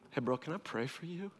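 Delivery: hushed, tender offer; gentle offer. Acted. Friend offering prayer after a confession, soft and gentle. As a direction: ask softly, as to someone exposed.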